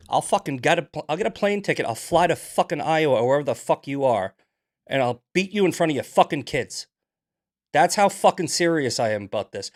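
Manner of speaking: heated rant. Real, not acted. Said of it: "I'm heated today".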